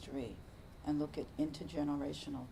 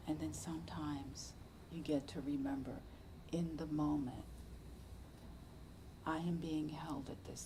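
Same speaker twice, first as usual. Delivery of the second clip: hushed contemplative teaching; 3 semitones lower, 5 dB quieter than usual. Acted. Teacher to listeners on being held in suffering, hushed and prayerful. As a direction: lay each phrase down gently like a prayer.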